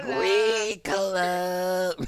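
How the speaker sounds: very slow, drawn-out jingle; mimicked wrecked voice. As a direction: stretch the word out like a strained jingle. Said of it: "She be like".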